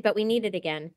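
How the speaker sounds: ordinary talk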